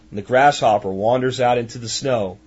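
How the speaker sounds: ordinary talk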